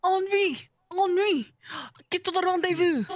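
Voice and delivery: a hint of a French officer yelling; French accent. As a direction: shout orders like the French soldier in Amnesia. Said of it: "whenever I think French, I think Amnesia the Bunker".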